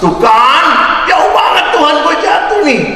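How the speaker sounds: hammed-up fright looking down; panicked shout. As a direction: yell in comic panic at the drop. Man cries out at the depth.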